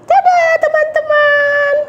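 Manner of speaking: put-on high, slow child goodbye; puppet girl Mimi. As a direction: wave friends goodbye as a little girl. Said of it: "Mimi tadi udah pulang".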